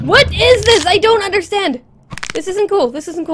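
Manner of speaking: high, loud frightened cries; panicked. Real, not acted. Player under attack, loud high panic. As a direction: cry out in panic.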